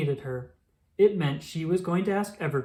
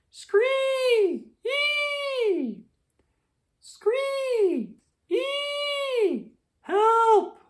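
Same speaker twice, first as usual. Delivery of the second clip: very high, shrill squealing rabbit cries; rabbit's squeal for help; 4 dB louder, much slower than usual. A narrator's reading. Trapped rabbit cries for help, very high squealing shrieks. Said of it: "cried a stranger".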